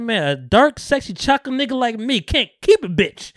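high, loud impersonated complaint; mock boastful lament. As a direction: perform the punchline with big comic indignation. Performing Franklin's favourite comic line.

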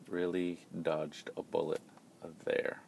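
usual speaking voice

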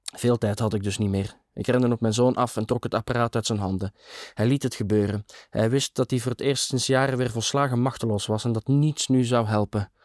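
ordinary delivery, nothing marked

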